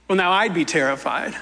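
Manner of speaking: ordinary talk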